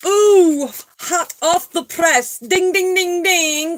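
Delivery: high, sing-song mock announcement; playful news flash. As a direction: ring it out high like a bell, playfully.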